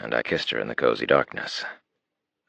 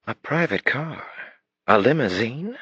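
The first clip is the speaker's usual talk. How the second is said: mild exclamation; 10 semitones higher than usual. Acted. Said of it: "Christine exclaimed".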